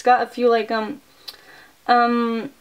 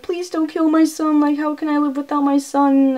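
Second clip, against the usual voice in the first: higher, pleading voice of a desperate mother; mock-pleading mother, voice raised; begging mom; 4 semitones higher than usual. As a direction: plead for the son's life. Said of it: "the mom's, like, begging".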